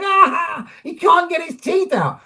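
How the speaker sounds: high, teasing voice of laughing crew; mocking crew. Crew laughing at the director stuck with vampire teeth, high mocking voice. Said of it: "they're all laughing at me, going".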